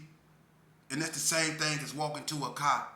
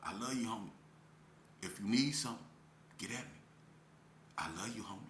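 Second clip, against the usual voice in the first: low, soft seductive promise; luring; 6 semitones lower, 8 dB quieter, faster than usual. Acted. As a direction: lure them in quietly, voice dropped. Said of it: "this how they lure you in".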